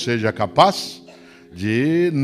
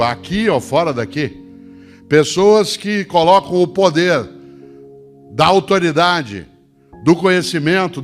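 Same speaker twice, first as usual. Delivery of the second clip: booming motivational speech; 4 semitones higher than usual. Acted. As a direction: lift the crowd with a big appeal. Rousing appeal to public servants.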